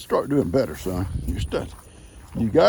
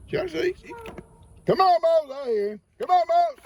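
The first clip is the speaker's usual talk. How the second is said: calling out. Real, not acted. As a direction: call the bull in from far off. Calling the bull across pasture.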